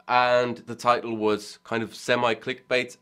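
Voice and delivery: usual speaking voice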